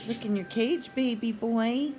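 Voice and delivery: usual speaking voice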